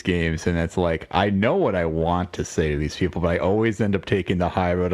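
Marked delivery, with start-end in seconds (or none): none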